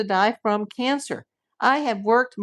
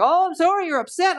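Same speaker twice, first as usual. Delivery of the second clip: high-pitched imitation of an apology; mock apologetic; 8 semitones higher than usual. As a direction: mimic her apology in a raised voice.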